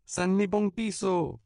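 put-on begging child cries out; pleading cry. A narrator's reading. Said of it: "cria l'un des enfants suppliants".

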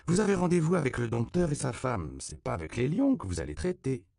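high, brisk telling-off; bossy. A narrator's reading. Flore reminds the children of their meeting, bossy. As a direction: set them straight.

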